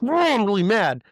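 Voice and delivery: high, loud burst of acted rage; mad outburst. Husband showing what his body feels like doing, mad outburst. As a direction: let pent-up anger roar out. Acts out bodily rage in retelling.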